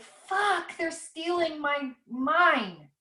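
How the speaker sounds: normal talking voice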